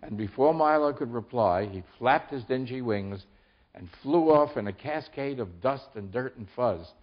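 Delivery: usual speaking voice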